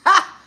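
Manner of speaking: one loud high bark of a laugh; sharp high scoffing laugh. Real, not acted. Laughing off a troll's comment, a loud high scoff.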